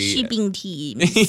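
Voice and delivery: put-on slow, drawn-out New Zealand accent. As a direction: say it like a Kiwi, slowly. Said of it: "like, a New Zealand accent".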